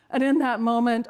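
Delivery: ordinary talk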